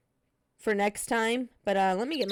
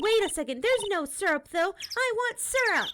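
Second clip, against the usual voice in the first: put-on higher, bubbly teenage girl voice, teasing; Maya character voice; 11 semitones higher than usual. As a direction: play the cheeky girl sidekick, pitched up. Voicing Maya again.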